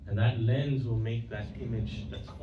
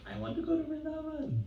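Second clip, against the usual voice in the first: voiced longing; 4 dB quieter than usual. His heart longs for the holy town, high yearning cry. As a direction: imitate a yearning wish in a high-pitched voice.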